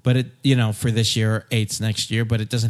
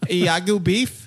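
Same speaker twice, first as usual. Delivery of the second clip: hammy stage voice; 5 semitones higher, slower than usual. Pretend. Hammy Shakespeare voice continued.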